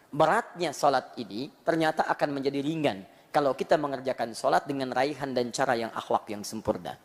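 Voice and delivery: ordinary talk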